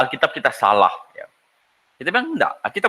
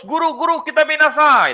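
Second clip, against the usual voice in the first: Peter's panicked cry; 9 semitones higher than usual. Retold or imitated. Peter calling the teacher in the storm, imitated panic. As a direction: shout for the teacher in alarm. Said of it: "mencatat teriakan Petrus".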